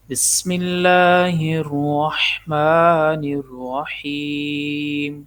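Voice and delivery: slow, drawn-out tajweed recitation; Quran recitation. Shown as a demonstration. Teacher demonstrating recitation for students, slow and measured. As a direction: recite each word slowly with care. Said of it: "تعوذ اور تسمیہ ... صحیح اور درست تلفظ کے ساتھ پڑھنا".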